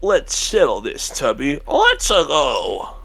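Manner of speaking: Mario voice. Retold or imitated. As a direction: drawl it out like a cartoon plumber. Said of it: "Mario says with Sonic's like".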